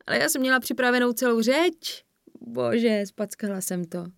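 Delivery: high wail sinking in self-reproach; moaning. A narrator's reading. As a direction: groan over having blown it. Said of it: "Zasténala a skryla si tvář". (zoufale, naříkavě)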